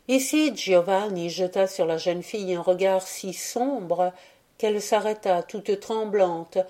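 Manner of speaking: ordinary delivery, nothing marked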